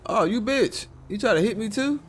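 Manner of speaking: high, indignant outburst; outraged surprise. Real, not acted. Cop outraged as a driver swerves at him. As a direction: react to being nearly hit.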